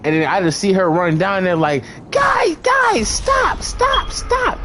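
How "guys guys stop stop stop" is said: high, shouted pleas to stop; girlfriend pleading. Retold or imitated. Retelling how the girlfriend begged the fighters to stop, high shout. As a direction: squeal at them to break it up.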